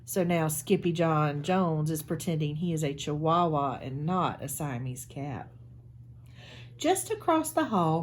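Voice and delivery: put-on lively read-aloud storytelling for kids; animated picture-book narration; storybook read-aloud. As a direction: read it like library story time for a class.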